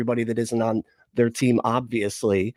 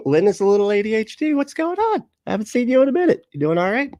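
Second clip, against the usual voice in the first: excited greeting; 10 semitones higher, 4 dB louder than usual. Real, not acted. Greeting chat member excitedly.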